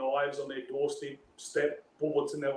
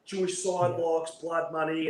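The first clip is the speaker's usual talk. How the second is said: mildly raised, mocking courtroom read-out; retold screaming; 2 semitones higher, slower than usual. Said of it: "they start screaming it".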